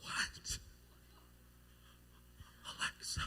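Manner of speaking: hurt, voiceless whisper; stunned whisper. Retold or imitated. Boy told to drown by his crush, stunned whisper. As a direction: whisper it in mock disbelief.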